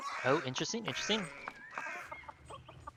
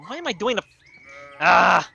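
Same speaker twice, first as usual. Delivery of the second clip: ordinary delivery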